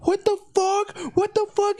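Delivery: high, panicked mock cry of alarm; freaked-out yelp. Friends freaking out at a condom on the couch, high mock panic. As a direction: squeal it like you just found something gross. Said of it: "he heard us fucking tripping out".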